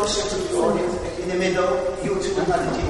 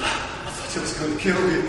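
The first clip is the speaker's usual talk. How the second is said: whispered, breathless relief; relieved whisper; 3 semitones lower, 3 dB quieter than usual. Shown as a demonstration. Drama teacher showing the genie's relief. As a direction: breathe it out as the fear passes. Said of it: "you know, like that".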